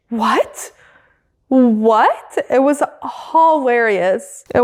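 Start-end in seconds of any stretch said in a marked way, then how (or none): 0.1-2.4 imitated squeaky rising "what?"; mock disbelief, swooping up high at the end; high-pitched "what". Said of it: "such a high-pitched".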